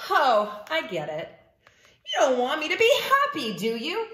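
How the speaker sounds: accusing, quick pigeon complaint; huffy burst after a knowing start; teenage-style huff. Acted. Pigeon accusing readers like a teenager, huffy. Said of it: "this sounds familiar".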